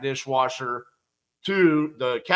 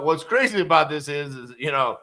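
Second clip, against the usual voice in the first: ordinary delivery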